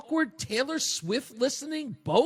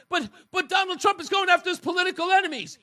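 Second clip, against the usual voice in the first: fast, higher mock outrage; mocking whine; 8 semitones higher, 3 dB louder, faster than usual. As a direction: parody their complaint, loud and quick. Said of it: "they come at you and they say".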